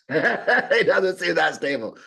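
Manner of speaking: repeated with a laugh, slow. Real, not acted. Speaker laughs repeating buyer's words. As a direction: chuckle through the punchline. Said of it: "laughs repeating".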